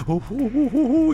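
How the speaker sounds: rising, high gleeful "ooh-hoo-hoo"; hammed-up villain's hoot; scheming chuckle. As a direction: hoot with wicked delight. Villain delights in her plan.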